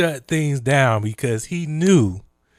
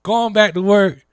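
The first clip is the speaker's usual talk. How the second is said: mocking Trump administration; 6 semitones higher, 6 dB louder than usual. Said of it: "they was like".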